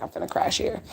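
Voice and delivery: tired whisper. Retold or imitated. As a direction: whisper it, worn out. Quoting own tiredness.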